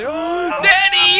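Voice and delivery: startled scream. Real, not acted. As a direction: gasp then high scream. Enemy dad appears.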